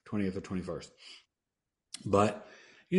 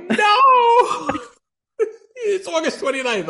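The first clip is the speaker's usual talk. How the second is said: much higher, louder laughing mock wife; 11 dB louder, slower than usual. Imitating his wife laughing at his October goodbye. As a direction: squeal it through laughter. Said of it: "my wife was like".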